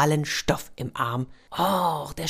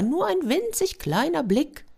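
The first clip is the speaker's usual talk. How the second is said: coaxing; 13 semitones higher than usual. Acted. Neighbour coaxing.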